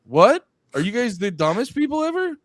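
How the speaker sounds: a loud, high, incredulous "What?"; hammed-up loud disbelief; mock disbelief. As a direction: pretend to be stunned at how dumb they are. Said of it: "And you're like".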